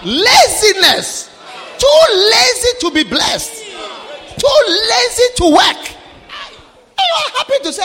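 loud high denouncing cry; preaching shout. Real, not acted. Preacher condemning lazy church brothers, loud high shout. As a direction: hammer the word lazy with scorn.

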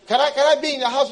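high, loud, fast eager begging; pleading believer. Acted. Believer begging God for closeness, pleading fast. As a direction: rush out the plea, desperate to be wanted.

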